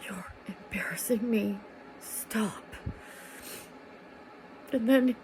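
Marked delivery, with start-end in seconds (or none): 0.1-2.6 hushed whisper through the teeth; whispered plea. Retold or imitated. Whispering to her boyfriend at the restaurant table, embarrassed hush. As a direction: whisper it so nobody nearby hears. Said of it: "And I'm like".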